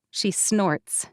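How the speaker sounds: ordinary delivery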